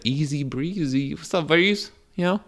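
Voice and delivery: mock compliment. Fake-complimenting someone with a nickname, breezy and high. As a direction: toss it off like a sing-song nickname. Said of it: "And they think it's ... like".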